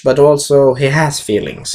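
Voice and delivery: ordinary delivery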